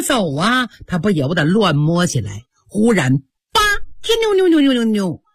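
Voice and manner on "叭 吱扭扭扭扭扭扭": imitated door creak. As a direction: mimic a latch clicking and hinge squeaking. Imitates mirror door creaking open. (模仿机关门吱扭作响)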